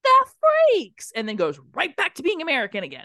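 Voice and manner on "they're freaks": gritty Cockney yell, much higher, louder and slow; dirty British accent. Retold or imitated. Nightclub hacker yells over music in dirty British. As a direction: bark it like a Gary Oldman thug. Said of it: "dirty Gary Oldman British".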